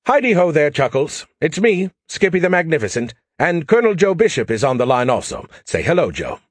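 high, loud, gleeful AI greeting; Skippy mockingly greets Chuckles; cocky alien AI.

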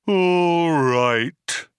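loud, high, drawn-out robot moan; long-suffering, resounding gloom; tolling cracked bell. A narrator's reading. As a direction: toll the words mournfully. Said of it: "like the tolling of a great cracked bell".

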